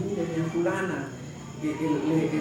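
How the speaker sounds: soft, low, hesitant comfort; consoling. Retold or imitated. Preacher retells comforting a woman worried about health, softly consoling. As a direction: feel for the words gently in a hushed voice. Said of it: "Yo traté de consolarla".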